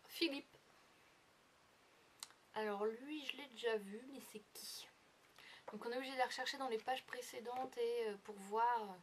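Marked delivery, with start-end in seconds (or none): 4.1-4.9 whispered. Retold or imitated. Puzzled reader's inner question.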